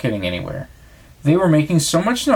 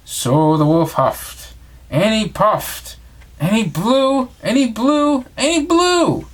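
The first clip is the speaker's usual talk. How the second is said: climbing steadily higher with each blew; blowing harder and harder; 9 semitones higher, slower than usual. A narrator's reading. Narrator acting out the wolf straining at the brick house, climbing higher. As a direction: build from slow puffs to a high strained blow.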